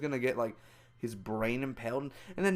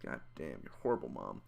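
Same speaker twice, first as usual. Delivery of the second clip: much lower, soft, quick mutter; muttered aside; 13 semitones lower, 9 dB quieter, faster than usual. Real, not acted. Vlogger judging the film's mom, low quick mutter. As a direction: grumble it under your breath.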